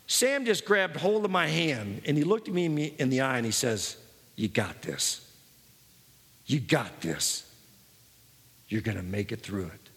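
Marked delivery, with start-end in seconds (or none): 4.4-9.9 low, soft, steady encouragement to a frightened friend; soft reassurance. Retold or imitated. Friend Sam steadying him through the kidney biopsy, soft low reassurance. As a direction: quietly reassure someone on the table, lower and softer.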